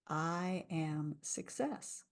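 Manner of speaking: very slow and soft, lifting at the end; slow affirmation. Shown as a demonstration. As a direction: draw it out gently. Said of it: "repeat after me and listen".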